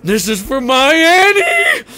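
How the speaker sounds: high, loud, slow vengeful shout; furious battle cry. Retold or imitated. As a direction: roar it as you pull the trigger. Sheriff fires at the killer.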